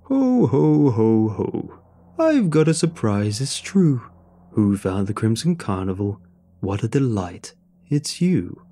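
big, booming ho-ho then sing-song rhyme; jovial clown. A narrator's reading. Welcome clown rhyming at a returning visitor, jovial and happy. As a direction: greet the guest as a merry carnival clown. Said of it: "He was jovial and happy again".

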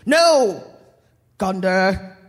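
loud dark lord refusal; villain voice. Acted. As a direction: deny it like a smug villain.

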